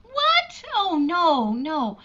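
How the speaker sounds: sharp high startled question; startled what. Acted. Aunt shocked by the uncle's question, startled cry. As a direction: jolt out the word in shock.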